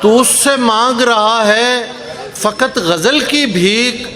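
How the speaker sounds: slow, measured mushaira recitation; poetry recitation. Acted. Poet reciting ghazal to a mushaira audience, measured declamation. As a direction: recite the couplets, drawing out key words.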